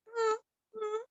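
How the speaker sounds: high, soft dog-like hum; wordless dog reply. Acted. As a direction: answer as a dog with small high hums. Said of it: "looked at him and was like".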